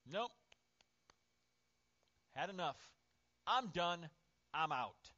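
firm, mildly exasperated dismissal; acting done with someone, cutting them off; fed up. Washing his hands of someone who failed, fed up.

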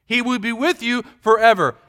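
normal talking voice

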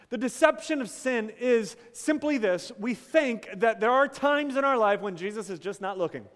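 full pulpit voice; preaching at full force. Acted. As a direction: preach it out to the congregation.

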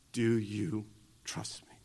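whispered, slow and soft; hushed question. Acted. God asks the listener to trust him, in a slow whisper. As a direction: breathe it like a secret.

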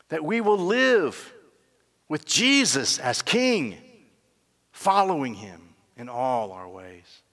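slow, weighty preaching; slow solemn sermon close; solemn exhortation. Acted. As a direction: lean on every word of the call. Preacher's slow closing appeal.

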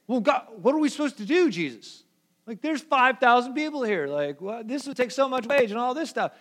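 high, fast flustered disciples pleading; panicky disciples. Retold or imitated. Disciples panicking to Jesus about feeding the crowd, high and rushed. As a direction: blurt it out like a panicked follower.

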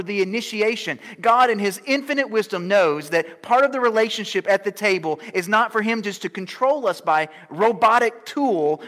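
loud, high preaching to the church; full-force preaching. Acted. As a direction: proclaim it with fire to the congregation.

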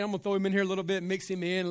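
ordinary talk, nothing marked

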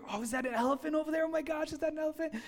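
breathless excited guessing; excited teammates. Retold or imitated. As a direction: spot an elephant from the van, thrilled. Retold excited teammates spotting elephants.

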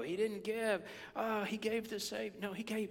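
ordinary delivery

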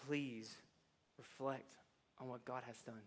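quiet, slow pastoral appeal; soft earnest plea. Real, not acted. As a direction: pastor urging the overwhelmed to reflect, softly.

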